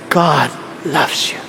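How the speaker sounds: very low, soft, drawn-out delivery; suave preacher. Retold or imitated. Imitating an admired pastor preaching to the crowd, suave. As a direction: drop deep and slow, like a smooth preacher. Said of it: "Pag ganyan, pag preach".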